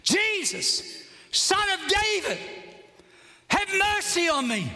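high-pitched calling out; acted shout. Blind man calling to Jesus from the roadside, acted shout. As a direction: cry out high, as if across a crowd. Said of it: "he began to shout".

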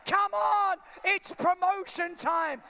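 "promotion time" shouted high and loud, slow; shouted declaration. Shown as a demonstration. Preacher models the words for the church, shouted high and slow. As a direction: belt out "it's promotion time". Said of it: "You just gotta say".

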